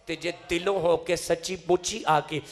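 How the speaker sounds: very loud sermon climax; full-force preaching. Real, not acted. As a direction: drive the promise home, rising to a cry.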